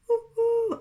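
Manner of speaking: very high, loud owl hoot. Acted. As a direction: hoot as the owl flies away. Owl flying off.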